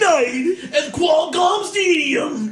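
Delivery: high, drawn-out stadium hype shout; event announcer parody. Pretend. Host turns his ultimatum into an event promo, announcer shout. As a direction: bellow a mock arena-show advert, stretched out.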